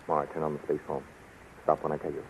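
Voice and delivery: low, soft, secretive voice; hushed instructions. Acted. Captain secretly directs his ally near the guard, hushed. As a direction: instruct an accomplice without being overheard.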